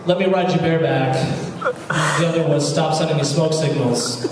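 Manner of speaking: usual speaking voice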